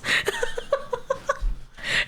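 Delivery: high airy burst of laughter; laughing. Real, not acted. Streamer breaks into laughter at the story. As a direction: crack up helplessly.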